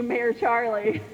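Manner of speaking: ordinary delivery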